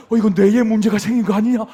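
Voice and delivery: loud alarmed cry; a father panicking over a nosebleed; panicked. Retold or imitated. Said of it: "기절 초풍하는". (코피 보고 크게 놀라 외치는 아빠)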